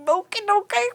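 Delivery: high, soothing mock placation; calm down someone yelling, mock-nervously; placating okay. Cashier placating a yelling customer, high mock nervousness. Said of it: "you're like".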